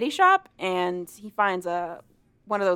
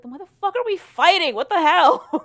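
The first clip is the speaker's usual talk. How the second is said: much higher, loud exasperated outburst; 10 semitones higher, 8 dB louder than usual. Retold or imitated. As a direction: throw up your hands and yell it. Jamie exasperated mid-fight.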